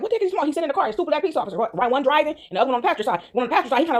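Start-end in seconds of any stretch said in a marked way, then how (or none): 0.0-0.5 high-pitched baffled question; incredulous, voice up high; confused disbelief. Retold or imitated. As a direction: act out puzzlement with the pitch lifted. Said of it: "looking confused".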